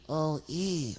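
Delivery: "O E" as two held vowels; O-E sounds. Acted. Sock practises letters for puppeteer, "O E" drawn out. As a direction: puppet stretching "O E", one vowel after the other. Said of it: "Say O E".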